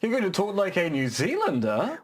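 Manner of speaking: exaggerated Kiwi uptalk ending on Zealander; rising terminal. Shown as a demonstration. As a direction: turn it into a question on New Zealander. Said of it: "known for the rising terminal".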